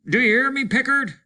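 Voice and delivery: loud, high shout to a man who won't answer; a desperate shout for attention. Acted. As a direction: yell it across at him.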